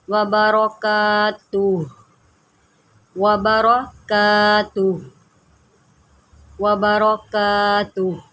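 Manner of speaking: व बरकातुह drawn out slowly, falling at the end; slow recitation. Shown as a demonstration. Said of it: "गौर से सुनिएगा".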